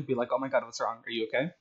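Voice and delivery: normal talking voice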